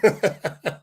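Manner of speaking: short husky laugh; quick laugh at himself. Real, not acted.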